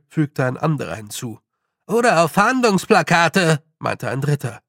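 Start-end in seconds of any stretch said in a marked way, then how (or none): 1.9-3.6 loud, high character voice; high, fretful clansman chiming in; alarmed little man. A narrator's reading. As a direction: cry out the next dreaded item. Third clansman chimes in alarmed.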